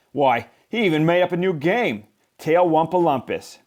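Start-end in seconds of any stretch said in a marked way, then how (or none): none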